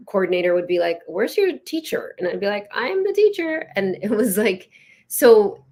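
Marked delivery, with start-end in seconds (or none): 1.1-2.2 high, slowed questioning voice; puzzled coordinator. Retold or imitated. Museum coordinator asks the young-looking teacher, puzzled and high. 2.7-3.7 much higher voice, insisting; retold reply pitched way up; high-pitched retort. Young-looking teacher answers the museum coordinator, high-pitched retort.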